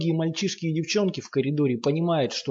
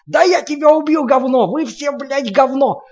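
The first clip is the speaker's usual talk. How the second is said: murderous rage; 12 semitones higher, 9 dB louder than usual. A narrator's reading. Lomov beating Sasha.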